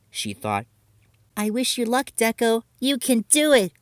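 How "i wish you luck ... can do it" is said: high, bright encouragement; sweet, higher cheer. Acted. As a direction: cheer your friend on from the side. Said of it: "cheered Kumin".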